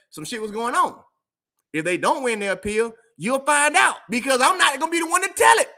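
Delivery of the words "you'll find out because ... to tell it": loud laughing declaration. Real, not acted. Loud laughing punch line.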